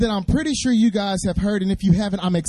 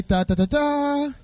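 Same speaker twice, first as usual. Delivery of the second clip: higher, slow trumpet-like ta ta; fanfare call; 7 semitones higher than usual. Shown as a demonstration. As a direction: sing out a fanfare. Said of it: "I need you guys to say".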